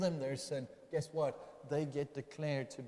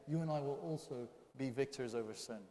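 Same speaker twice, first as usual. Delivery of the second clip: ordinary talk, nothing marked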